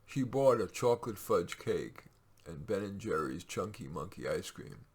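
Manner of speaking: plain reading voice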